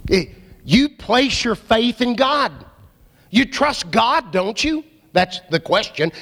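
normal talking voice

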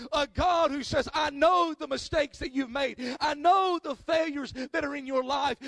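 loud, rising preacher's call to the congregation; preaching at full force. Acted. Pastor stirring up the church, preaching loud and full. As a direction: preach it out to the whole room.

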